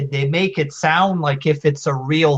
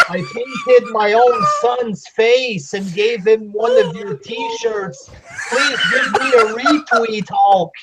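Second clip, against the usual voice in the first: mock fan voice; 10 semitones higher than usual. Fan begging Hulk Hogan online for a retweet, shrill mock pleading.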